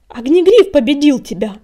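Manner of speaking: shouted. A narrator's reading. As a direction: shout it out in challenge. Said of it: "крикнул Крутобок".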